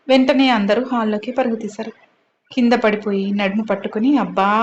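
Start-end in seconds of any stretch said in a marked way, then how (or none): none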